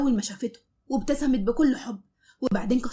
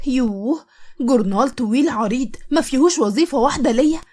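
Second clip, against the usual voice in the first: loud, animated storytelling; lively story narration; 6 dB louder than usual. A narrator's reading. Narrator telling a comic sisters story, loud and lively. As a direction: read it with bold energy. Louder energetic second story reading.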